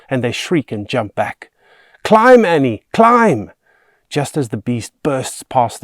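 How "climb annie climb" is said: high, loud desperate yelling; frantic shout. A narrator's reading. Boy to the girl as the beast lunges, shouting frantically. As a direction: yell to send her climbing.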